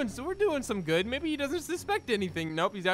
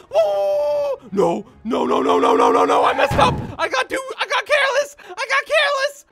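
high loud anguished shouting; despairing wail; 9 dB louder than usual. Real, not acted. Gamer getting caught, high loud despairing wail. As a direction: cry out at the mistake. Sudden failure.

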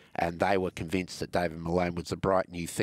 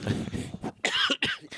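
sharp cough. Real, not acted.

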